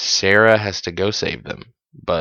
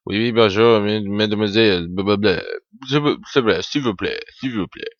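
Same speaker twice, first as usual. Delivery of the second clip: bad French waiter, babbled and trailing into a mumble; terrible French accent; faster than usual. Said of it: "Chuck's terrible French waiter accent".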